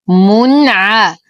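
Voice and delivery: the ر doubled and heavy, recited very slowly; slow recitation. Shown as a demonstration. Teacher demonstrates the doubled heavy ر, slow chant. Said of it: "نشد الراء مع التفخيم".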